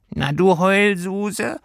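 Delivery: high, slow, teasing creature voice; grinning little creature. Acted. Homesickness creature teasing Lilli, high and slow. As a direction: tease her like a grinning furry imp. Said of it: "sagte das Heimweh grinsend".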